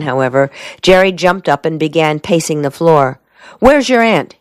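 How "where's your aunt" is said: abrupt question, pitched up high; sudden demand. A narrator's reading. Pacing soldier suddenly asks after her aunt, demanding. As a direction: demand it out of the blue. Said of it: "he demanded suddenly".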